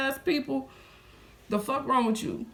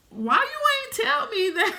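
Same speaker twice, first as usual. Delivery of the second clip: much higher, laughing disbelief; incredulous high question; 12 semitones higher than usual. Retold or imitated. Asking the cashier why she didn't say, high laughing disbelief. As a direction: ask it in shocked disbelief.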